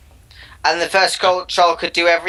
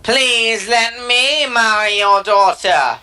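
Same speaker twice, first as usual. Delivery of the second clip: higher, slow, drawn-out troll voice; slow, higher put-on troll begging the king; pleading troll; 4 semitones higher, much slower than usual. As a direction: stretch every word like a timid suitor.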